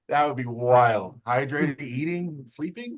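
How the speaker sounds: high-pitched sarcastic disbelief; mock incredulity. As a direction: act scandalized at the very idea, pitch climbing. Mocking non-athlete arm wrestlers' disbelief.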